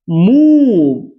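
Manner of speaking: मूव held very long and loud; drawn-out model word. Shown as a demonstration. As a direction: stretch the oo in मूव slowly. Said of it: "देखिए इसे पढ़ते हैं मूव". (मूव को बहुत खींचकर ज़ोर से)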